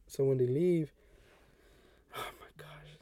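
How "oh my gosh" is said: whispered oh my gosh. Real, not acted. Storyteller dreading the noisy roommate, whispered. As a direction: breathe it out under your breath. Roommate starts keeping him awake.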